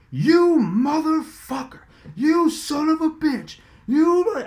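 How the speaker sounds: high, slow furious cussing; angry cussing. Retold or imitated. Coach cussing out the reporter, furious. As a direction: rage at him down the phone line.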